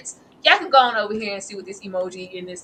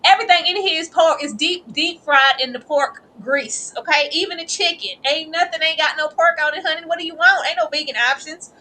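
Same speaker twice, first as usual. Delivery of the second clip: sass the customer about the pork; country waitress; 6 semitones higher, 3 dB louder than usual. Acted. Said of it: "this heifer acting like".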